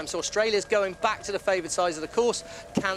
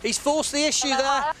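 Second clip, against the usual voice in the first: higher, urgent play-by-play over a foul; excited live call; 5 semitones higher than usual. Real, not acted. Calls the foul incident live.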